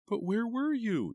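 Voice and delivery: very high, loud and slow demand. A narrator's reading. Eva confronts Tanya, high loud demand. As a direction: insist on an answer. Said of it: "Eva demanded".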